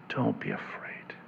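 soft unvoiced whisper, gentle and close; whispered comfort. Preacher whispers Jesus's comfort to the frightened listener, soft whisper. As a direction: breathe it like a hushed promise.

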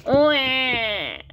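high imitated baby wail; mock infant wah; baby's cry. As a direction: bawl like a baby, drawn out. Baby Polly cries.

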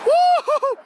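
high, loud whoop of joy; excited whoop. Real, not acted. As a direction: shout for the fish. Friend hooks a fish.

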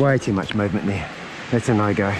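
ordinary talk, nothing marked

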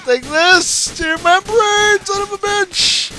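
high, loud hammy taunt; raging gamer. Pretend. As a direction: gloat like a vengeful hero. Taunting enemy in game.

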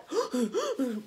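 mimed gasps for breath; quick acted gulps of air; gasping for air. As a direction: gasp like you just surfaced. Mimes breathing after being underwater.

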